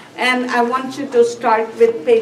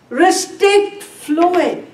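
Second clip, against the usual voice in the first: slow emphasis; 5 semitones higher, much slower than usual. Shown as a demonstration. Teacher stresses key nursing answer.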